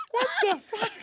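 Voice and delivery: shocked. Retold or imitated. His own shocked reaction.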